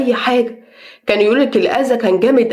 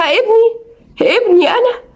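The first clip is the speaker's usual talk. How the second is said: as if struck by the news; shocked disbelief; 9 semitones higher, slower than usual. Retold or imitated. Mother hearing the witch confess, in shocked disbelief. Learns neighbour harmed her son.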